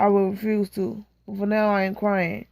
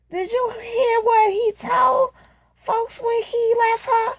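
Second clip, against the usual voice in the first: much higher, slow gossiping woman; 11 semitones higher, slower than usual. Acted. A friend teasing the others with gossip, high and slow. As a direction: lean in with juicy gossip. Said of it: "gossip dialogue".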